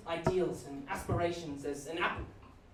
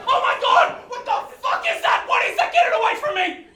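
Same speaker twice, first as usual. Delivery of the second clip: shrieking, much higher, very loud horror; horrified father; 14 dB louder than usual. Acted. Father meeting his newborn, screaming in horror. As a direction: scream in disgust at the baby. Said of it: "my impression of my father".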